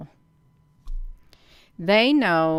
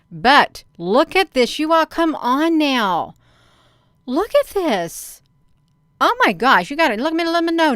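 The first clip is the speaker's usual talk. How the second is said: excited; 7 semitones higher, 6 dB louder than usual. Real, not acted. Excited at a shocking passage.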